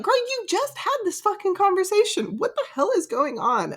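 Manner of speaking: high incredulous scolding; exasperated. Retold or imitated. Reader scolding shocked Violet, high incredulous voice. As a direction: throw up your hands at her. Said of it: "I'm like".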